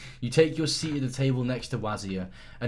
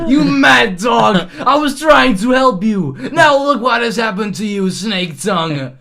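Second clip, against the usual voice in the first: furious shout; 9 semitones higher, 10 dB louder than usual. Acted. Player yelling at Hunwald after the fight, furious shout. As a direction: scream it at the dog who turned on you. Player yells at dying dog.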